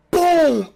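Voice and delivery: loud mouth "boom" like a hit landing; punch sound effect. Retold or imitated. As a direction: shout the impact noise high and loud.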